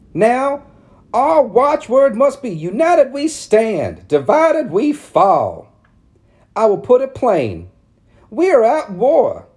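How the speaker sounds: prim matron laying down the law; high, loud lecturing town lady. A narrator's reading. Narrator voicing Missus Cady warning Mama, high and preachy. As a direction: press each point like a sermon. Ladies voiced in read story.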